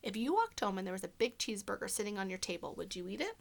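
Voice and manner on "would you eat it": plain reading voice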